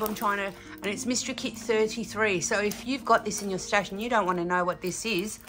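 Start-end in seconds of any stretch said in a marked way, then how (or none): none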